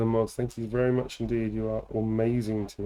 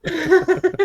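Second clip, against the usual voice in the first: laughing; 5 dB louder than usual. Real, not acted. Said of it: "taken on a whole new meaning now".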